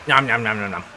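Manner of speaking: playful nom nom chomping; gnawing noise. Retold or imitated. Imitates an enemy eating him.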